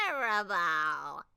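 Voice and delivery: put-on loud, slow, drawn-out old witch wail. Old witch wails over her yellow kitten. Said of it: "she wailed".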